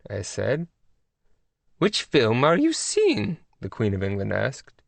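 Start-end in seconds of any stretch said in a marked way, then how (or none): 1.8-3.4 high, slow regal English lady; lofty high royal tone; queenly voice. A narrator's reading. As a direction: scold gently with royal poise. Queen of England on phone.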